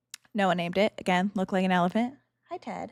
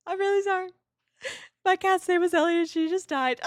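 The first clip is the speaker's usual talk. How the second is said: retold bawling apology; 10 semitones higher than usual. Streamer retelling how she cried to a new classmate, mock sobbing. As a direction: replay the tearful apology, pitched way up. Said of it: "I start bawling in school".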